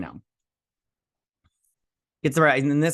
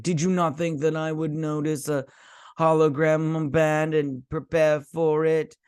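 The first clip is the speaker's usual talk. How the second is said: higher, slowed put-on villain voice; imitated character voice; 3 semitones higher than usual. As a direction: mimic his odd voice, dragging it out. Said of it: "I don't like the voice he did".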